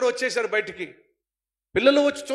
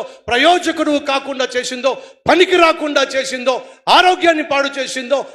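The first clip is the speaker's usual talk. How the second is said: preaching at full force; 3 semitones higher, 8 dB louder than usual. Acted. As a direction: plead with each listener to be freed. Altar call preaching. (ఉత్సాహభరితమైన ప్రసంగం)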